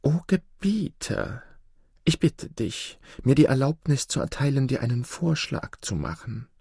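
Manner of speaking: high, meek fox voice; humble fox. A narrator's reading. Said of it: "Mit gesenkten Augen näherte er sich". (demütiger Fuchs, hohe Stimme)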